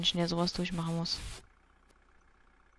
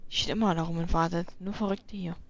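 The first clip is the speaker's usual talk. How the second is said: ordinary delivery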